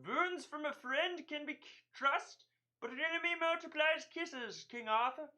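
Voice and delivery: high, loud, mocking French accent; hammed-up French accent jeering at King Arthur; taunting Monty Python Frenchman. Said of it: "something he would taunt".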